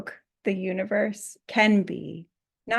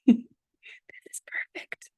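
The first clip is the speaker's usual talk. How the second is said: whispered irony; 3 semitones higher, faster than usual. Real, not acted. Mocks her own mistake.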